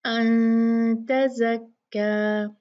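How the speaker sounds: अन with the noon hidden in a two-count nasal hum; slow recitation, नून of अन hummed and concealed. Shown as a demonstration. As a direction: hold a two-beat ghunna on अन before तज़क्का. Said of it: "दो count गुन्ना करके नून को छुपाएंगे".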